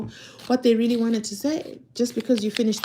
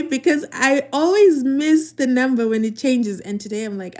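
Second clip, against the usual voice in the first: ordinary talk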